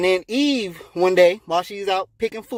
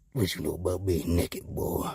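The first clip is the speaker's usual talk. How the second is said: very low, soft suspicious question; stern God; 9 dB quieter than usual. Acted. God questions Adam, low and stern. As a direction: drop low and menacing to the culprit.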